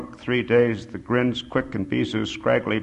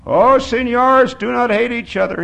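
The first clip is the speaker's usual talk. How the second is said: crying out; 11 semitones higher, 8 dB louder than usual. A narrator's reading. Sobbing old man pleads with the men, crying out. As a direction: implore them at raised pitch. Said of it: "he cried out. He's sobbing".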